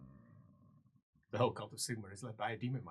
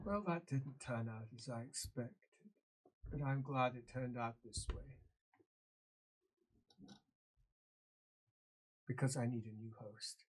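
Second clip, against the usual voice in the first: put-on quiet, softer, menacing old voice; sinister old ruler; 5 dB quieter than usual. Possessed Grand Theogenist reveals his plan, quietly sinister. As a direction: let the threat creep in softly.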